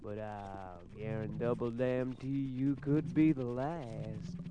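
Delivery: low, slow seeping voice; like a gas. Acted. The voice replying like a gas, low and slow. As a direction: let it seep out low and slow. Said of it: "the voice said like a gas".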